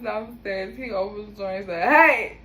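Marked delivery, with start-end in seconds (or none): 1.8-2.4 loud barked hey like to dogs; loud commanding hey, higher; sharp hey. Retold or imitated. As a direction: snap the room to attention. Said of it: "Just like I've been saying I do with the dogs".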